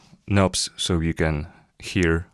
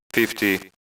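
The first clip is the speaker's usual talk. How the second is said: normal talking voice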